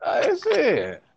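high, puzzled mock voice; high mock confusion over a strange place; mock bewildered. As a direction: play lost and baffled.

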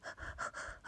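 long calming exhale; heavy wordless sigh; deep breath. Real, not acted. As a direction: breathe out the frustration. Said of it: "Okay, deep breaths".

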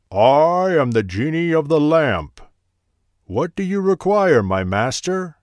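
loud, high, grand genie. Acted. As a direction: boom it out like a mighty spirit. Genie of the lamp appears.

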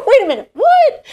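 high, loud, laughing disbelief as the husband; husband on the phone, laughing in shock; incredulous husband. Retold or imitated. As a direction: play him gobsmacked, laughing. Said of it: "He's like".

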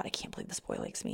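breathless whisper to herself; whispered thought. Retold or imitated. Girl whispering her disbelief about her crush. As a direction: whisper it, amazed he likes her.

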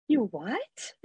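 quietly taken aback, acting out the reaction; mock disbelief. Podcaster recalls hearing praise for their voice, incredulous.

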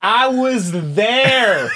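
high, drawn-out, theatrical; slow, high, hammy testimony; dramatic witness voice. Pretend.

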